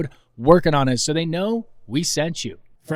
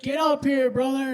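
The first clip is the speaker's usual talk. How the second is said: high-pitched mock call; retold summons; 9 semitones higher than usual. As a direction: imitate beckoning someone up on stage. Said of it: "and you were like".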